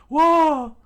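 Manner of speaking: very high, very loud yelp; scream. Acted. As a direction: yelp as you dodge.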